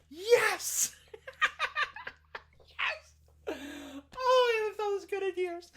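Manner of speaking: high, loud through laughter; laughing with joy. Acted. Daughter answering her father, laughing with joy. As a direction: laugh out the words happily.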